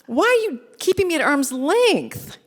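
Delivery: high pleading question; Bruce pressing. Retold or imitated. Bruce asking the journalist why she holds back. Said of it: "He said".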